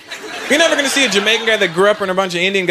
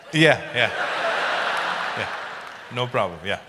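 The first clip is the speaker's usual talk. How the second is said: hesitant groom; 8 semitones lower, 8 dB quieter than usual. Retold or imitated. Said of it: "you hesitated".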